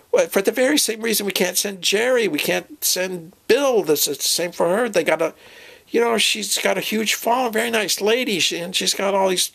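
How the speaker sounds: normal talking voice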